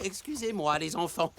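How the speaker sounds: ordinary talk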